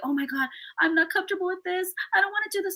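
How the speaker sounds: high, fretful retold friend; upset friend. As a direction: voice the nervous girl near tears.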